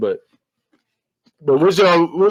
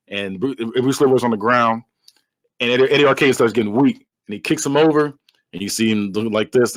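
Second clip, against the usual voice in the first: ordinary talk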